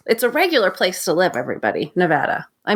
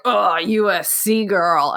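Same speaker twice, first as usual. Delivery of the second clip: dismissive sneer; slower than usual. Retold or imitated. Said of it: "not like".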